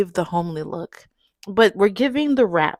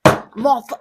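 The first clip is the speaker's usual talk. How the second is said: ordinary talk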